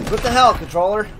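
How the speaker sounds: normal talking voice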